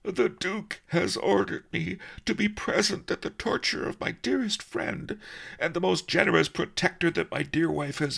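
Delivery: high, fretful, theatrical rabbit voice; melodramatic Key Rabbit. A narrator's reading. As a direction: lament your fate like a tragic actor. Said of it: "The little fellow posed dramatically".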